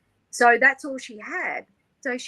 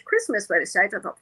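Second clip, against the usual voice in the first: usual speaking voice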